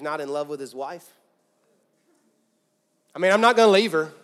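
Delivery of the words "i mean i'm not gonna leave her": loud Southern husband. Acted. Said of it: "I don't know why he's Southern".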